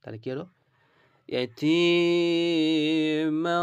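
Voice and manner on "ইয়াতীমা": ইয়াতীমা ফাআওয়া chanted slowly and louder; slow recitation demo. Shown as a demonstration. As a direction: recite it out, vowels long. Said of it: "এইভাবে করে". (তেলাওয়াতের সুরে টেনে পড়া)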